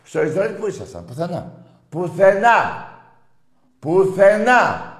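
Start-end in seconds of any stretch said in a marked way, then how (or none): 1.9-4.9 higher and louder, dragged out slowly; drawn-out yelled nowhere; slow shouted taunt. Real, not acted. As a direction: bellow the word slowly to rub it in. Repeats word as shouted taunt.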